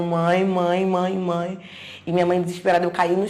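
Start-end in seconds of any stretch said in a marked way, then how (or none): 0.0-1.6 moderately raised, repeated call; retold yelling. Daughter running down the hospital corridor to her mother, retold yell. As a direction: imitate calling out for mum, louder but not screaming.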